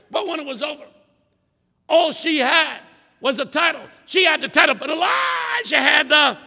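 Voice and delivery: high, loud preacher's climb; imitating the preacher sweeping through the Bible. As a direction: build the sweep to a shouted peak. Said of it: "Can't you just hear him?".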